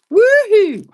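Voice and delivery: high, loud whoop; celebratory woohoo. Real, not acted. Host cheers for winner Patty, celebratory whoop.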